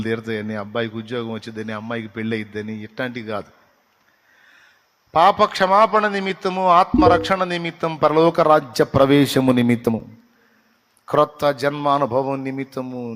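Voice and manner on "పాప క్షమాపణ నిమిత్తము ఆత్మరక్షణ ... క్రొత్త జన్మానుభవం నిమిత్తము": fervent preaching. Acted. Preaching the true reasons. (గట్టిగా బోధిస్తూ ప్రకటించడం)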